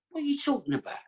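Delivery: can't believe what he's hearing; shocked disbelief. Retold or imitated.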